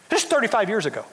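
normal talking voice

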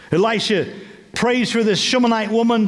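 ordinary talk

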